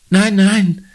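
put-on high, shaken girl's voice, lightly stammered; stammering. Conny stammers to the worried woman. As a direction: get the words out while still shaken. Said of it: "stammelt Conny".

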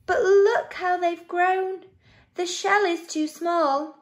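high, animated storytelling; dramatic concern. A narrator's reading. Narrator showing children the crowded shell, dramatic concern. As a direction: lift the voice with mounting concern.

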